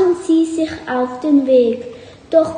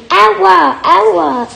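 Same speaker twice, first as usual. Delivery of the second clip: higher yelp of "ow"; cry of pain; 4 semitones higher, 4 dB louder than usual. Acted. Bert runs blindly into the door, pained yelp. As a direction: yelp as if you bumped into something.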